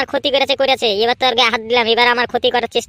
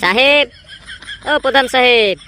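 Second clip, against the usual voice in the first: calling out; slower than usual. Acted. Calling out to the boss.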